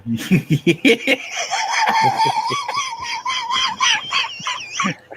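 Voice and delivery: helpless high laughter; long laughing fit. Real, not acted. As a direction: lose it laughing for seconds. Both cracking up.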